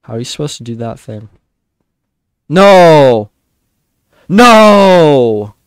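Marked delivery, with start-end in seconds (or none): 2.5-5.5 high, loud anguished no; despairing shout. Real, not acted. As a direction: cry out in dismay. Player dies at the end.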